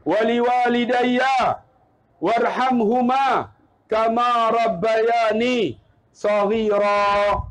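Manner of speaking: very slow, drawn-out recitation; leading prayer. Shown as a demonstration. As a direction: lead phrase by phrase, pausing for others to follow. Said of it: "Cuba ikut saya". (memimpin doa perlahan-lahan untuk diikuti)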